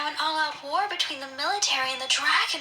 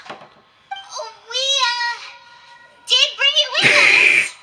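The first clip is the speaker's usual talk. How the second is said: louder, higher, halting and near tears; flustered panic; 7 semitones higher, 6 dB louder, much slower than usual. Acted. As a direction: blurt it out, panicking and slow. Hesitant confession about the lyre.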